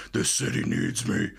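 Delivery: Batman voice. Retold or imitated. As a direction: growl it like a brooding vigilante. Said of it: "he's doing the Batman voice".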